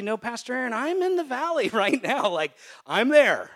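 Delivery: hammed-up laughing complaint; mock protest. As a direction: play a worried congregant pushing back. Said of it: "even if you say".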